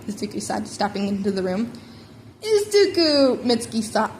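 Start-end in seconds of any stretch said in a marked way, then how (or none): 2.4-3.4 excited greeting. A narrator's reading. Mitsuki greets nephew warmly.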